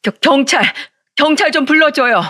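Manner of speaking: high, urgent, stammered cry; shouted call for help. A narrator's reading. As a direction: call out for the police in alarm. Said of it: "종업원한테 소리쳤습니다".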